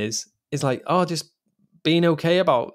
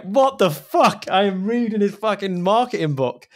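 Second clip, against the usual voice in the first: high-pitched, incredulous outburst; retold tearful disbelief; 7 semitones higher, 3 dB louder than usual. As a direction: exclaim it, baffled at your own tears. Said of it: "I was crying. I was like".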